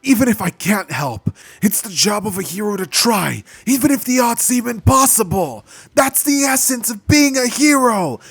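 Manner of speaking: high, loud impassioned shout building up; yelling. Acted. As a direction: shout it like a hero's vow. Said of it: "Leaving Deku to yell out".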